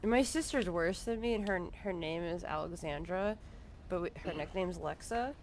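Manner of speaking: ordinary talk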